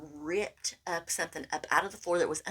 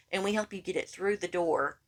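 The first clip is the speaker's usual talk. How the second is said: ordinary talk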